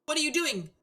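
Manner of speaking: put-on a desperate girl calling through a door; frantic young woman. Giselle begging Michael to come back, high frantic voice.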